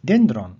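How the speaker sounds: "Dendron" with a loud DEN, falling; stress on first syllable. Shown as a demonstration. Teacher showing oxus on penult, "Dendron" stressed on DEN. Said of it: "Here the accent is penult".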